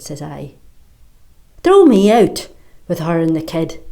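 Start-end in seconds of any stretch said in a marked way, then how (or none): 1.6-3.8 loud, hard challenge to the bully; squaring up to a bully who threatens a mother; defiant challenge. Acted. Defiant, loud dare.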